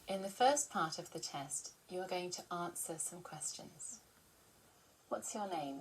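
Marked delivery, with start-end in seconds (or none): none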